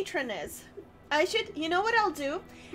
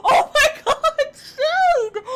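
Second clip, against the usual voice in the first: excited shout; 10 semitones higher, 11 dB louder, much slower than usual. Real, not acted.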